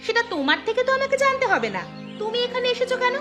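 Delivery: high-pitched, haughty annoyance; annoyed retort. Acted. Bride tells off the old woman who woke her, annoyed. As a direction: put the intruder in their place, pitched high.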